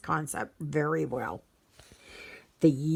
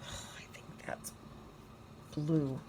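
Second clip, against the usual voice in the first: whisper; 2 semitones lower, 13 dB quieter than usual. Real, not acted. Aside judging a colour.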